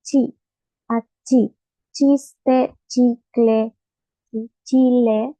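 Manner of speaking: each "chi" in "achí, chiste, chicle, chile" hit loud and crisp; forceful teaching demo; strong chi. Shown as a demonstration. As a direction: bark out every chi like a sneeze. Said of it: "Así de fuerte tiene que sonar ese chi".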